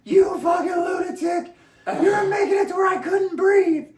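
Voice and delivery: high, loud shrieked accusation; hammed-up high scream of a furious woman; mock shrieking woman.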